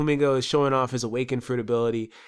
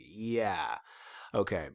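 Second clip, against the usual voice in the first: unimpressed; 5 semitones lower, 10 dB quieter, much slower than usual. Retold or imitated. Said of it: "I was like".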